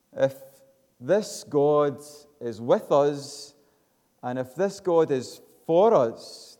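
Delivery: high, loud rising sermon climax; preacher lifting the church to praise, loud and high; full-force preaching. Acted. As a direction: preach it to the rafters, building.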